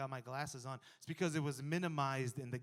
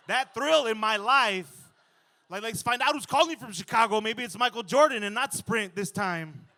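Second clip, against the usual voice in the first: loud, high giddy thrill; thrilled fantasy; 8 semitones higher, 12 dB louder than usual. Pretend. Dreaming of a Chicago call, loud giddy thrill. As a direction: savor the thrill of an unknown caller.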